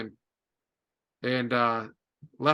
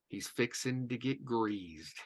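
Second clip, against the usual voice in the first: retold ominous reply; 4 semitones lower, 11 dB quieter, slower than usual.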